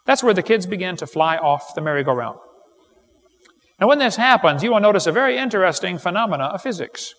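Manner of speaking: ordinary talk, nothing marked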